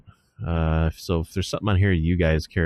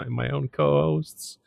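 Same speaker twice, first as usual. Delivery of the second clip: amused disbelief, higher; laughing; 8 semitones higher than usual. Real, not acted. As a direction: still giggling, half-protesting. Still laughing at the rickroll.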